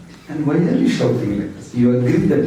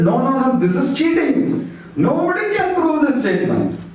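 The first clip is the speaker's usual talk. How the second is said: indignant protest; 10 semitones higher than usual. Retold or imitated. Scholar protests being cheated.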